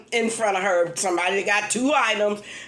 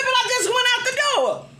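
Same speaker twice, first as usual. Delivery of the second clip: pitched way up in disbelief; exasperated protest; 11 semitones higher than usual. Retold or imitated. Customer to cashier who re-rang her items, high exasperated protest. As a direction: squeal it like you can't believe it.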